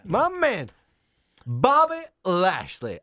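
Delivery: Lio Rush hype voice. Pretend.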